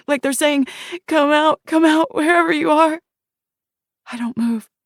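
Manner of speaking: put-on whisper-fast fear of a child hiding; terrified child. Shiloh as a child hiding from the monster, terrified high voice.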